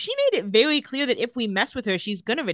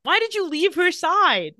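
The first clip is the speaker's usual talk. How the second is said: higher, louder, drawn-out mock outrage; accusing outburst; 9 semitones higher, 6 dB louder than usual. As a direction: stretch out the accusation, hammed-up. Acted quarrel, Elena challenges Stefan.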